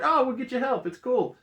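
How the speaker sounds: light, high-pitched mock reassurance; easygoing reassurance. Character offering help to supposed drug addict, imitated reassuringly.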